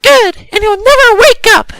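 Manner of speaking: high, very loud devilish shout; shouting mother. A narrator's reading. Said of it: "shouted Joey's mother".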